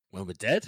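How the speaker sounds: "When we're dead?" lifting sharply on "dead"; questioning. Acted. Grimm answering his weary scouting partner, questioning. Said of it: "he says it like a question".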